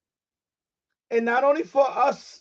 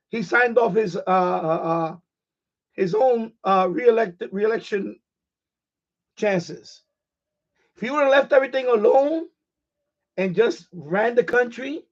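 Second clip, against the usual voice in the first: ordinary delivery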